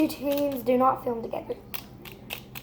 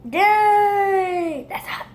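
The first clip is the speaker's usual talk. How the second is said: loud high yelp on dang; pained yelp; 7 semitones higher, 6 dB louder, much slower than usual. Real, not acted. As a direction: cry out at the heat. Repeated with loud high cry.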